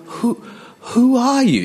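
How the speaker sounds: high, halting wonder; amazed, high-pitched falling question; awed question. Acted. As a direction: stammer once, then swoop up high and loud. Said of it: "It could have been like that".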